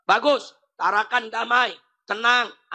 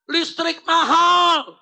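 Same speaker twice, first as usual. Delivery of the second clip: loud, higher, drawn-out protest shout; imitating protesting fathers; 4 semitones higher, 5 dB louder, much slower than usual. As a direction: shout it slowly like a protest slogan. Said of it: "protes juga. Apa bapak-bapak bilang?". (menirukan teriakan protes bapak-bapak)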